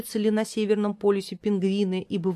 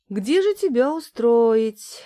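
slow, thoughtful higher mother voice; pondering mom voice; 5 semitones higher, 6 dB louder, slower than usual. Acted. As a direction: think it over slowly, motherly. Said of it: "задумалась мама".

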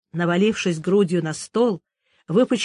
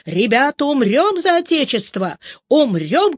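higher, louder cry of a general leading the charge; rallying battle cry; 6 semitones higher, 4 dB louder than usual. Retold or imitated. Old soldier retelling how Kutuzov cried to the troops, loud rallying call. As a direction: rouse the troops to die for the fatherland. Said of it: "и кричит он нам".

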